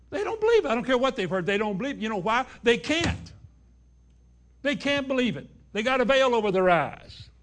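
loud, high preaching build; forceful sermon climax; preaching at full force. Real, not acted. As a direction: drive it home to the congregation.

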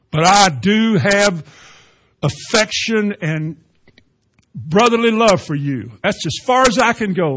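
slow, high, loud declaration, phrase by phrase; Peter pleading his limit. Acted.

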